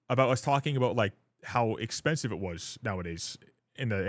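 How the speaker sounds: normal talking voice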